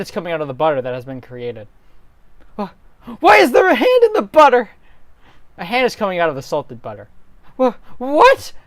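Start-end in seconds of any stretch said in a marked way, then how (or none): 2.6-4.7 high, loud startled cry; startled character voice. Acted. Link gasping at a hand in the butter. 7.6-8.5 high, loud startled cry; startled character voice. Acted. Link gasping at a hand in the butter.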